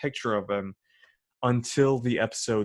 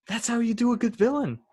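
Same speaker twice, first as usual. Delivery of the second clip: fast, much higher, excited; 11 semitones higher, faster than usual. Real, not acted. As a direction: burst out with delight. Excited praise of villain.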